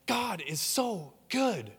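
slow, soft, tearful awe; choked-up praise, moved to tears; welling up. Real, not acted. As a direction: let the tears catch the words. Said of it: "I well up".

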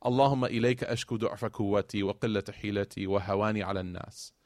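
recited Arabic prayer, softening at the end; Prophet's plea to Allah, recited in Arabic; Arabic supplication. Retold or imitated. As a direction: pray it as a personal plea. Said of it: "a person crying to Allah".

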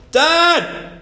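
very high, loud yell. A narrator's reading. Boy calling his father after only echoes, shouting hard. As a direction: bellow for someone out of sight. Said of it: "he shouted louder".

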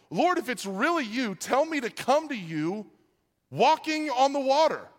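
high-pitched, excited calling to Jesus. A narrator's reading. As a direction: call out across the water, building at the end. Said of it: "Then Peter called to him".

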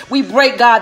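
ordinary talk

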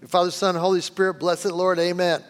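rattled off quickly and carelessly, like a chore; a new in-law hurrying through grace; rushed prayer. Retold or imitated. New bride at the family table, rushing grace. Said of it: "It's the pace. Slow down".

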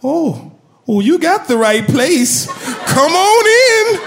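a cheerful grandmotherly welcome, slow at the end; smiling grandma. Retold or imitated. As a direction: welcome him in like a sweet grandmother. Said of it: "then she smiles and says".

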